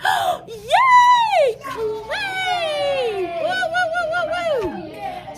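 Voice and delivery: very high, drawn-out gleeful squeal; joy scream. Retold or imitated. Teacher imitating pupils told they're doing clay, joyful screams. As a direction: imitate overjoyed kids, squealing and whooping. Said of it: "those are joy screams".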